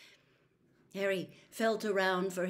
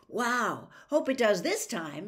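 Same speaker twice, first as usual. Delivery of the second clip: louder, eager and bright-eyed; enthusiastic; 4 semitones higher, 7 dB louder than usual. A narrator's reading. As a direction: cheer at the thought of a long match. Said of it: "said Harry enthusiastically".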